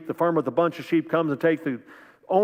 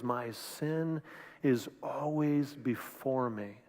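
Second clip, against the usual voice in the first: much softer, slow, broken confession; owning his sin; 2 semitones lower, 10 dB quieter, much slower than usual. Retold or imitated. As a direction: let the words sink, barely above a breath. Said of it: "he owns it".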